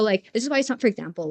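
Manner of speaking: ordinary talk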